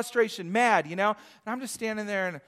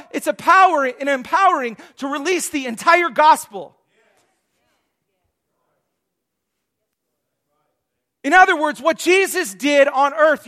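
loud, high, emphatic sermon delivery; full-force preaching; 6 semitones higher, 10 dB louder than usual. Real, not acted. Preacher charging the church to witness, emphatic preaching. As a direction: preach it out with punch.

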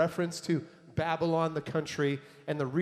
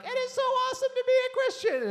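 much higher, gushing mock delight; euphoric high; 4 dB louder than usual. Preacher mimics a believer's emotional high, gushing. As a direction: play the giddy believer on a spiritual high. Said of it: "You would go from one moment being like".

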